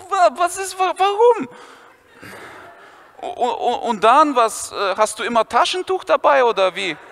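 high panicked schoolboy; flustered Viktor. Retold or imitated. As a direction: squeak in stammering disbelief.

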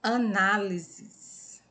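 "Análises" very slow, leaning on "ná"; drawn-out tonic syllable. Shown as a demonstration. Teacher helping pupils find the tonic syllable, "Análises" slowly stretched. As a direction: stretch out "Análises" so the stress shows. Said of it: "Olha a sílaba tônica dela".

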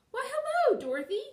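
very high, loud hello; astonished call to a friend; surprised greeting. A narrator's reading. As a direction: swoop up in delighted recognition. Delighted greeting called up.

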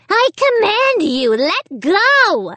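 high-pitched commanding squeal. A narrator's reading. Pinned dummy commanding Amy to let go, squealing. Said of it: "he squealed".

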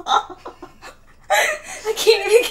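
breaking up with laughter; can't stop laughing. Real, not acted. Laughing during challenge.

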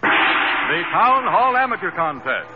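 normal talking voice

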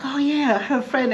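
high, bright-eyed imitation; mock eager American.

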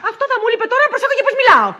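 loud, high-pitched indignation; indignant refusal. Acted. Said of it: "μίλα πιο σιγά".

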